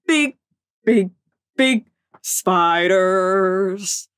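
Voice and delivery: slowed-down, dragged-out big big big; drawn-out spooky warning; slow dramatic. Pretend. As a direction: stretch each big out slowly like a creeping horror. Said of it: "Guys, there's big".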